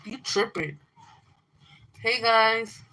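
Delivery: ordinary talk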